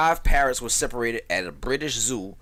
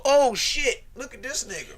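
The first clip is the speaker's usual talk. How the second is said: high, loud shocked cry; exclaiming in shock; mock surprise; 12 semitones higher, 6 dB louder than usual. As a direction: act surprised at spotting him. People spotting fugitive online.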